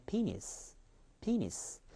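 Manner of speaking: "penis" joined up, PEE high, -nis dropping softly; stress on the first syllable. Shown as a demonstration. Teacher models the stressed word for students, front-stressed "penis". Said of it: "강세 때문에".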